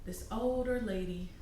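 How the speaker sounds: soft guided-meditation narration, phrase by phrase; guided visualization. Acted. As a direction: guide the listener gently along an imagined path.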